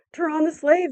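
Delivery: high, outraged cry; crowd cry. A narrator's reading. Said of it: "they cried then".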